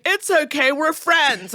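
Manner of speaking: imitating a cop trying to be friendly; overfriendly officer. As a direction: reassure them like a too-eager pal. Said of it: "Officer Yelp trying to be like".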